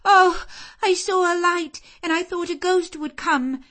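high, loud, trembling terror; frightened child. Acted. Jane telling the servants about the ghost, terrified. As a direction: blurt it out in fear.

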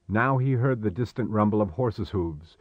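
ordinary delivery

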